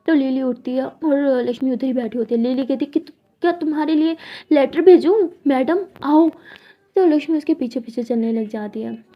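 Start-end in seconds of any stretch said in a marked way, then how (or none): 3.0-6.3 louder, slow mocking call; sarcastic mockery. Lily mocking Lakshmi for refusing her leftovers. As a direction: sneer at her like calling a madam.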